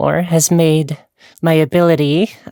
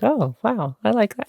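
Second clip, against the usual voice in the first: mock admiring; 6 semitones higher, 4 dB quieter than usual. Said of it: "I just start to go like".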